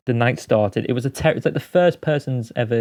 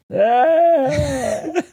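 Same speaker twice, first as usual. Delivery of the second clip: long, high wordless drone; drunken vowel noise; 14 semitones higher than usual. Retold or imitated. Drunk actor stood at the bar, making vowel sounds. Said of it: "making vowel sounds".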